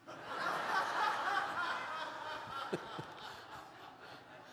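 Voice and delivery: real high burst of laughter. Real, not acted. Pastor laughing at the rental punchline, high and real. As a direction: laugh at your own joke.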